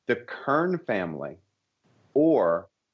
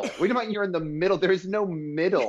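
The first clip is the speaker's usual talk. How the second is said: indignant; 8 semitones higher, faster than usual. Acted. Mock outrage at neutrality.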